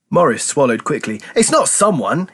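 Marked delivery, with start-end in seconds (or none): 1.4-2.3 hissed. A narrator's reading. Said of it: "he hissed".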